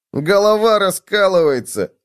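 high-pitched complaint of pain; whining. A narrator's reading. Bedbug with a sore head, whining. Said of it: "заныл Клоп".

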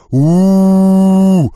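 long loud bestial roar; roaring grunting brute. A narrator's reading. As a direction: roar like a giant ape. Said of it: "взревела зверюга".

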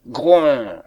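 loud falling animal grunt; bear grunt. A narrator's reading. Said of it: "le cri des animaux".

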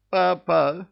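bleated high and loud like a goat; bleating cry. Acted. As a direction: bleat the word Папа! out. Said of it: "проблеял трус".

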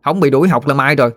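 acted tattling child standing up boldly; defiant boy. A narrator's reading. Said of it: "Nó nghinh nghinh mặt lên".

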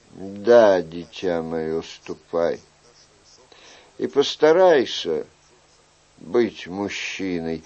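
paternal king. Acted. King sends young man off to be a man, paternal. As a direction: let the youth go softly, with pauses.

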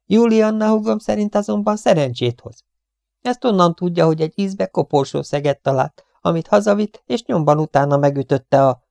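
high-pitched superstitious gossip; chattering cleaning woman, earnest. A narrator's reading. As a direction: explain the sister's belief eagerly. Voices the talkative cleaning woman. (fecsegő takarítónő magas hangon mesél)

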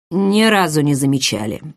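plain reading voice

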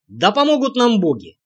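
ordinary delivery

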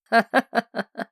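short sinister chuckle; mocking laugh. A narrator's reading. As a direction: laugh at her choice. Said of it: "рассмеялся кто-то". (насмешливый смешок)

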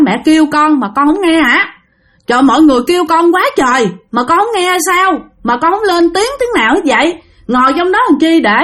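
high-pitched scolding questions; exasperated mother. Retold or imitated. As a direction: act a mother fussing at her child in disbelief. Mother scolds found child. (giọng mẹ trách con, cao và dồn dập)

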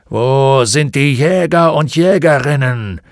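impatient centaur snorting the words. A narrator's reading. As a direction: huff the question out impatiently. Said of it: "schnaubte er".

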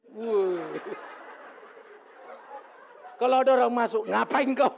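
loud, laughing shout at intruders; a playful loud guard call; mock yell. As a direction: bellow as a joking statue. Acting his statue yelling at visitors.

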